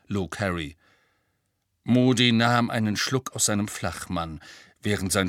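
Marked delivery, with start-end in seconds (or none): none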